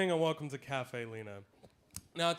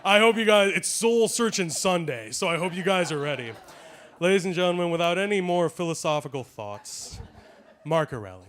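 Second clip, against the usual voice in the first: announcer hype; 9 dB louder than usual. Acted. Host introducing the performer.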